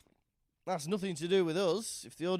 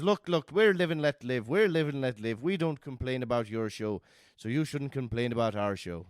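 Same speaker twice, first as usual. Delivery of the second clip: louder, pushy neighbour voice; mock insistent Irish neighbour; 7 dB louder than usual. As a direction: insist loudly, a hammed-up Irish fella. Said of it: "he goes".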